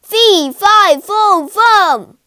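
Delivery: very loud, high-pitched giant's chant; booming giant. A narrator's reading. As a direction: boom it out across the room like a giant. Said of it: "a giant voice echo around the room".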